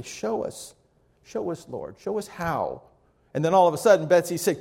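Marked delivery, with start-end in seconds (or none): 0.0-2.8 soft, halting plea, acted out; hushed prayer. A narrator's reading. Betsy praying for help in the camp, hushed pleading. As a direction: pray it quietly, pausing between pleas. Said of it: "began to pray".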